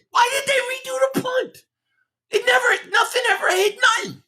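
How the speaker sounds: very high, cracking shout of disbelief; exasperated outcry. Real, not acted. Fan ranting to viewers about the punt, cracking high outcry. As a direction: wail it like you can't believe it.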